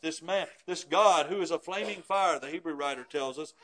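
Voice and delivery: ordinary talk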